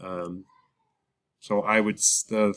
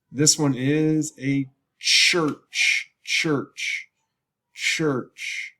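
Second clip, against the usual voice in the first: slow high demo; 5 semitones higher, 4 dB louder, much slower than usual. Shown as a demonstration. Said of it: "lesson demo of church".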